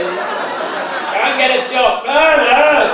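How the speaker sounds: loud, high, slow mock yell; a nervous newbie bawling his heckle; Bernard's heckle. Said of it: "Bernard comes along".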